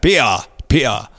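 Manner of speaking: American trying a Cockney "Peter", no T; Cockney imitation. Shown as a demonstration. Host copying the author's Cockney word, Cockney accent attempt. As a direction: copy the Cockney glottal stop in "Peter". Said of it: "Oh, I like that".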